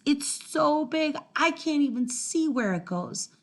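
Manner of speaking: ordinary delivery, nothing marked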